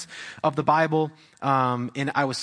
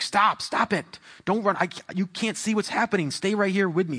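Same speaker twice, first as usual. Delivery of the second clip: higher-pitched, insistent parental warning; urgent fatherly warning; 5 semitones higher than usual. Retold or imitated. Dad to daughter running around parked cars, urgent warning. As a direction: act out a dad telling his child to stop.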